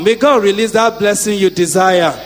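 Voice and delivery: loud, chanting blessing; fervent prayer. Acted. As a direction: pray it over them with force. Praying blessings at full force.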